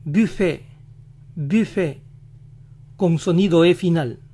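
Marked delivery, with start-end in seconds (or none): 0.0-2.0 "buffet" in French, silent T; French "buffet" ending in a pure é; French pronunciation. Shown as a demonstration. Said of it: "en francés se pronuncia".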